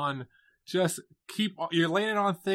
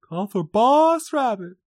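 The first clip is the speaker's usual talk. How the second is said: mock dramatic; 5 semitones higher, 8 dB louder, slower than usual.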